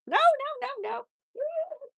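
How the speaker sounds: very high, rising alarmed no's; urgent no. Real, not acted. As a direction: stop the bunny before it slips out the door. Stopping the bunny from escaping.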